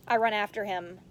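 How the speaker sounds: ordinary delivery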